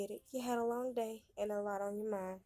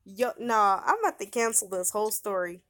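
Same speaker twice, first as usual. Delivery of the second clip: loud, stressing about; fed-up announcement; 11 dB louder than usual. Real, not acted. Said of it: "Bro, I'm heated".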